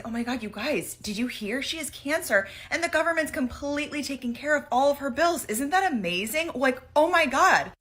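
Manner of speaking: high, gushing mock amazement; gushing excitement. Telling two friends abroad the news, gushing high. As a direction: retell your naive past self gushing.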